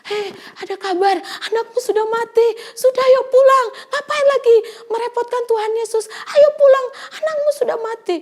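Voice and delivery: high, loud, urgent bad-news cry; frantic messenger telling the father his child has died. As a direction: rush the bad news out, near tears. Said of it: "Mungkin dia kata gini".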